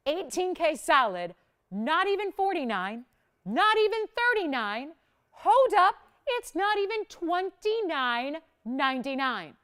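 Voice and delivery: building excitement. Real, not acted. Host keeps slashing the price.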